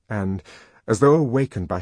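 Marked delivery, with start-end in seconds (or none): none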